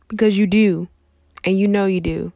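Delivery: loud, insistent reassurance; emphatic. Real, not acted. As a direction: drive it home. Emphatic encouragement.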